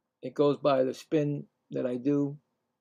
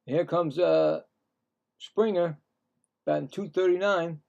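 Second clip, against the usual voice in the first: steady game call, a little raised; announcer voice for the at-bat; play-by-play announcer; 4 semitones higher, 3 dB louder than usual. Acted. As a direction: call each pitch like the broadcast booth. Calling batters and pitches.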